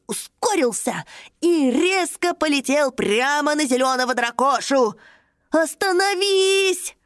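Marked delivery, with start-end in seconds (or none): 5.5-6.9 high, slow shouted command; crying out. Acted. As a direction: cry out to stop it. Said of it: "вскричал малыш-садовод".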